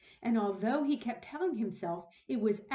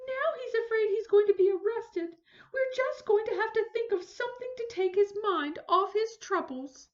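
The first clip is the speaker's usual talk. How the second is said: mildly worried lady; 11 semitones higher, 6 dB louder than usual. Acted. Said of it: "sighed Missus Prim".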